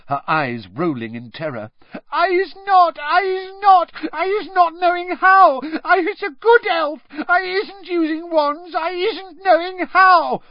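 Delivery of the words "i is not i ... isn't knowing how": very high, loud, tearful elf voice; panicked elf pleading. A narrator's reading. House-elf begs the wizards to believe her, tearful and shrill. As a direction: plead desperately, close to sobbing.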